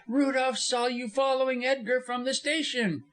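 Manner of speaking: high-pitched woman's accusation; heated widow finding her voice; crying accusation. A narrator's reading. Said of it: "cried Missus Moxton, finding her voice".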